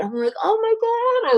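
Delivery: thrilled at a big bag of paintings; overjoyed gasp. Retold or imitated. Retelling her delight at Ed's gift, high excited squeal.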